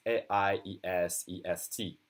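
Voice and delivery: ordinary talk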